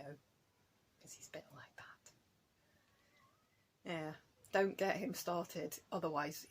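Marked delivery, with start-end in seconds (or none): 0.0-2.1 hushed aside. Real, not acted. Confiding aside about him.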